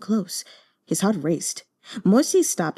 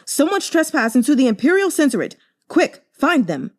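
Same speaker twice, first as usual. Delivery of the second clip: higher, louder alarmed guard shout; 7 semitones higher, 4 dB louder than usual. Acted. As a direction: raise the alarm from across the hall. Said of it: "Footsteps and shouts rang out".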